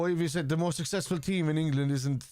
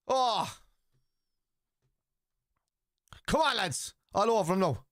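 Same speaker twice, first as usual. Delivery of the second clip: cheering; 6 semitones higher, slower than usual. Real, not acted. Cheering the team on.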